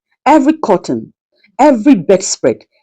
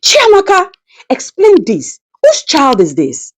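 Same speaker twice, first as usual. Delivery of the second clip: a man shouting at his lover; shouted; 8 semitones higher than usual. A narrator's reading. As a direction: shout her name and demand answers. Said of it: "and shouted".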